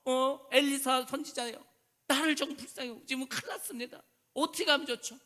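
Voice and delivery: a mother begging the prophet for help; desperate widow's plea. Retold or imitated. Preacher voicing the widow pleading with Elisha, desperate cry. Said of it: "뭐 울면서 말할 것 같아요".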